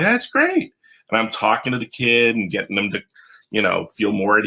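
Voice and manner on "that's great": enthusiastic. Retold or imitated. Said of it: "And I'm like".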